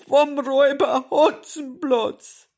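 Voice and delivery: high, very loud, slow wail; distraught grandmother. A narrator's reading. Grandmother naming the robber to the policeman, wailing. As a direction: cry out the culprit in outrage.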